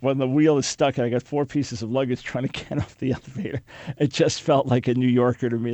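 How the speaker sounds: ordinary talk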